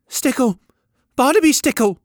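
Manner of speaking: put-on high, loud small boy announcing his name; boy calling out his name, high and loud; boy's high eager voice. A narrator's reading. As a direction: pipe up proudly as a young lad.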